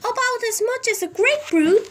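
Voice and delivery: high, quick and sorry; fretful old lady. A narrator's reading. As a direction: rattle it off in dismay. Mrs Silver, repeated by Hoppy.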